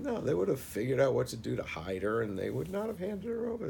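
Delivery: insistent retort. Retold or imitated. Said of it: "He said".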